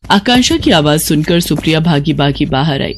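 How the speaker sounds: ordinary delivery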